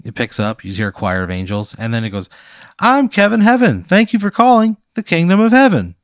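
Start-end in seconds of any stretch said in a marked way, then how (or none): 2.8-6.0 high, loud pitchman on a recording; recorded phone greeting. Acted. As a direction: read it like a canned phone greeting. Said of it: "it's a recording".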